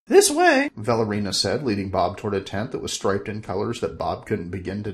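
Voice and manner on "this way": high, louder sorceress voice; Valerina's voice. Acted. Valerina asking Bob, high sorceress voice. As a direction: ask it innocently as the sorceress.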